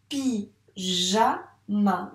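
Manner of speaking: "Pyjama" split pi... ja... ma with pauses; teacher separating pi, ja, ma; syllable by syllable. Shown as a demonstration. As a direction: count out "Pyjama" in three slow beats. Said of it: "dans quelle syllabe il y a le son".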